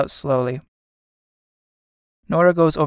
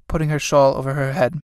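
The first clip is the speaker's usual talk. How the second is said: ordinary talk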